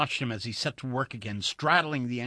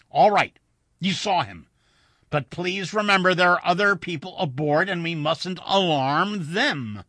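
louder, higher, emphatic appeal; firm placating; 7 semitones higher, 10 dB louder than usual. A narrator's reading. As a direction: humour him firmly, urge restraint. Pilot placates agitated passenger.

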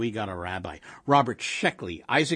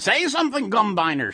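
high, loud demand to a husband; exasperated old wife; 10 semitones higher, 5 dB louder than usual. A narrator's reading. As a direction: prod him sharply, voice raised. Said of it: "she demanded".

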